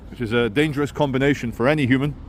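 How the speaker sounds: normal talking voice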